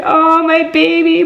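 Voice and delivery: high, loud, drawn-out coo; gushing pity. Real, not acted. Fan pities heartbroken hero, loud gushing coo. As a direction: wail in sympathy. Coos over broken hero.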